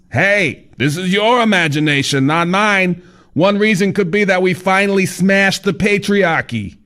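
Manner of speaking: high, breezy know-it-all guide; fat man's voice. Acted. Fat man explaining to Kyle, high cheery voice. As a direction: lecture the writer like it's obvious.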